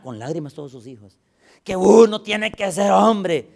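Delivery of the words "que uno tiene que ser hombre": más alto y fuerte, lento y rogando; ruégale al padre con fuerza; súplica llorosa. Retold or imitated. Hijos llorando al padre. (hijos al padre, súplica fuerte y lenta)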